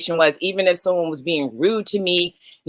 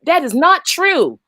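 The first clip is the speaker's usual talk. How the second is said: indignant denial; 6 semitones higher than usual. Retold or imitated. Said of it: "and you may say".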